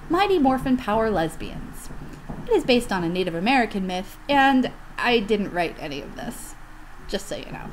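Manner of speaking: loud, deep announcer read; movie trailer announcer; announcer intro. Acted. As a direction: boom it out like a trailer voice. Dramatic reading intro.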